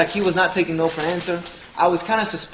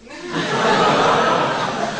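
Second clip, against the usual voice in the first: high, breathless burst of laughter; a real high laugh bursts out; big real laugh; 13 semitones higher than usual. Real, not acted. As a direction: crack up at your own punchline. Laughing at his own joke.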